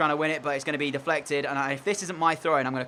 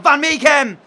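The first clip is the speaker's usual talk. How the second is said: much higher and louder, yelling the name; excited shout; 11 semitones higher, 12 dB louder, slower than usual. Real, not acted. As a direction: cheer the striker on. Shot on goal, cheering.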